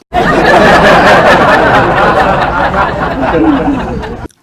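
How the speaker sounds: loud high burst of laughter; big laugh. Real, not acted. Teller laughing after the queen joke, loud laugh. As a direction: crack up at own joke.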